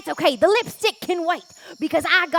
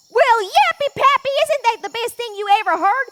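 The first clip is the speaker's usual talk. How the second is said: excited exclamation; 6 semitones higher, 6 dB louder, faster than usual. Acted. Delighted exclamation at good news.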